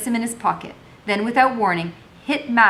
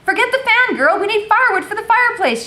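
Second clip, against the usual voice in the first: much higher, louder stern order; stern mistress; 6 dB louder than usual. A narrator's reading. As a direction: bark it at the servant girl. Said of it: "she said sternly".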